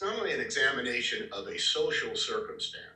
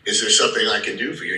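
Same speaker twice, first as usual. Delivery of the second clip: a touch of a curt hostile shopkeeper, quicker; 8 dB louder, faster than usual. Shown as a demonstration. Shopkeeper who hates the customer, mildly curt and fast. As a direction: toss it at them like they're the enemy. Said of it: "obviously hates your guts".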